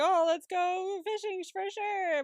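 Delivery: high, drawn-out mock enthusiasm; feigned eagerness. Co-host voicing her eager act about fishing, feigned enthusiasm. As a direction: act out a sing-song, eager yes.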